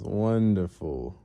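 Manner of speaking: slow, drawn-out approval; villain's satisfaction. Retold or imitated. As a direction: savour the word as a villain boss. Villain pleased with the deal.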